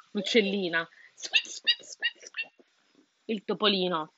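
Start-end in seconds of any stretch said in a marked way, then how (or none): 1.1-2.5 high, softer squeaks; tiny faint mouse squeaks. A narrator's reading. Narrator squeaking softly as the mouse.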